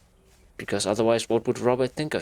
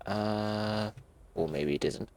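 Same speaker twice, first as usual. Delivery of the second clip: ordinary talk, nothing marked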